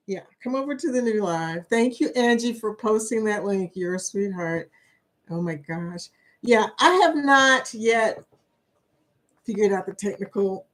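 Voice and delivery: ordinary talk, nothing marked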